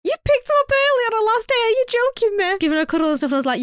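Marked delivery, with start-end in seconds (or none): 0.0-2.6 high, playful indignation; high-pitched mock disbelief at the mum; mock outrage. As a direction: tease the mum, high and scandalised.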